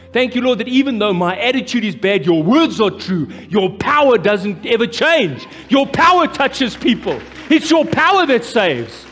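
fervent prayer rising to a shouted sending out; full-force preaching. Acted. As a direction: proclaim it like a revival climax.

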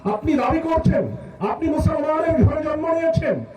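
high, ringing sermon voice; declaimed, sustained high-pitched preaching; impassioned preaching. Acted. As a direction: exhort the congregation with full force. Preaches at full force.

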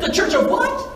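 very high, incredulous question; mock an appalled mom's outburst; high-pitched indignant disbelief, acted out; upset mother. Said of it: "she's angry with me".

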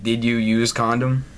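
deadpan mock quote; imitating awkward phrasing. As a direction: flatly mimic the broken English. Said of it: "I love when people talk like that".

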